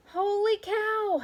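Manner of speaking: high, slow drawn-out holler. A narrator's reading. Manny the cook hollers in surprise, drawn out. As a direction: holler it out long. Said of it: "hollered Manny the cook".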